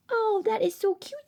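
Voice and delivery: ordinary delivery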